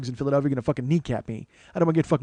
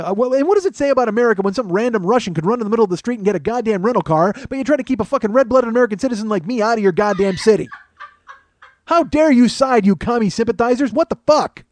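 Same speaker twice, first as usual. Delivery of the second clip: loud, high, hammed-up indignation; outraged tirade; 6 semitones higher, 6 dB louder than usual. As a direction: rail at the whole city as a wronged citizen. Comic tirade against Philadelphia.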